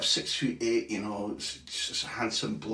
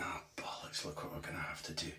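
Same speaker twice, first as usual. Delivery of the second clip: whispered, much softer muttering; hushed cursing so others won't hear; the chief whispering curses under his breath; mock whisper; 7 semitones lower, 14 dB quieter than usual. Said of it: "he's going".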